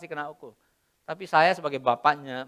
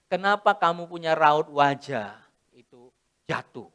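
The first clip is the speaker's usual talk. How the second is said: normal talking voice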